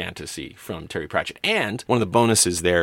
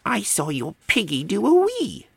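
put-on high-pitched gleeful little kid; cheeky small child, very high voice; bobble hat sniggering; 14 semitones higher than usual. A narrator's reading. Child telling on the Hogfather's pig, high and cheeky. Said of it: "The bobble hat sniggered".

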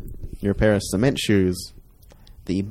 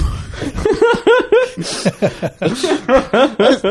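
loud laugh; 4 dB louder than usual. Real, not acted. Laughs after own rant.

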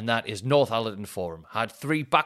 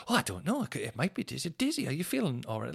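higher-pitched, lightly voiced concern; concerned family imitation; 5 semitones higher, 4 dB quieter than usual. Family asking their dog if it is ill, mock concern. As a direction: mimic owners fretting over their pet.

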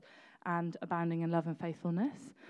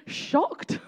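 shocked; 14 semitones higher, 4 dB louder than usual. Pretend. Describing dad's face in photo.

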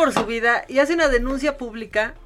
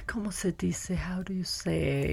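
put-on whispered, slow, as a girl groping for words; breathy fake struggle to remember Spanish; mock forgetful snob; 5 semitones lower, 13 dB quieter than usual. Mocking girls back from the US, a whispered fake struggle. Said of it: "mocking girls who forgot Spanish".

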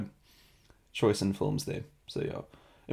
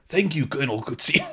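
higher mock South African accent, laughing; exaggerated South African movie line cracking up; 4 semitones higher than usual. Host quoting Blood Diamond, laughing South African accent. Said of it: "go watch clips from Blood Diamond".

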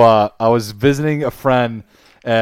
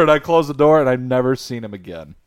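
ordinary talk

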